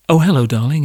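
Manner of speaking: normal talking voice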